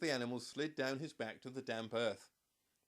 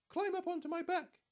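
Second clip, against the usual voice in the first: very high, squeaky and a bit louder; squeaky giraffe; 5 dB louder than usual. A narrator's reading. Giraffe warning the animals, high and squeaky. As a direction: squeak it out like an alarmed little giraffe. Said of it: "he squeaked in his high voice".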